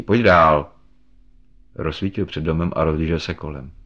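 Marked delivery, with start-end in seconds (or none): 0.0-0.7 loud, hearty call to come in. A narrator's reading. Thompson calls his unseen friend inside, calling out loudly. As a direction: call it out to someone outside.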